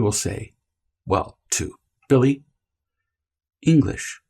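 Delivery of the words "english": calm and even. Shown as a demonstration. Parent to whining Billy, calm flat correction. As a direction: say it evenly to the child, no anger. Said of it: "did you hear my tone of voice?".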